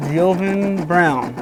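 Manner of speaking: slow, tentative attempt at an unfamiliar name; sounding out name. Real, not acted. Host reading a viewer's name, slowly sounding it out. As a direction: stretch the name out carefully, unsure of it. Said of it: "how do you pronounce that".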